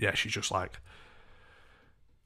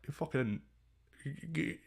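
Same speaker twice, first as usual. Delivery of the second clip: normal talking voice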